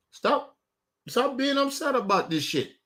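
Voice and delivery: ordinary talk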